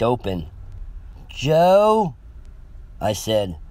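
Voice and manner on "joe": higher, questioning call into a house; tentative call. A narrator's reading. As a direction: call out hoping someone answers. Calling hopefully into silent house.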